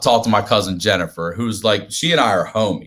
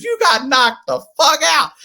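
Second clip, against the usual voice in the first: laughing taunt; 14 semitones higher than usual. Retold or imitated. Jennifer mocking her knocked-out brother.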